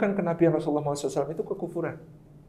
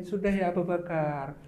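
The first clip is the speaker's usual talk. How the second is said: calm husband voice, much softer; calm quiet reply; 10 dB quieter than usual. Retold or imitated. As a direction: gently hold off the father-in-law. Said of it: "Rasulullah bilang".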